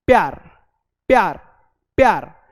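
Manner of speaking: "प्यार" repeated fast and clipped; quick pyaar drill. Shown as a demonstration. Teacher drilling pupils on quick pyaar. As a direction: drill "प्यार" with a short p each time. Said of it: "आप तेजी से खत्म करोगे".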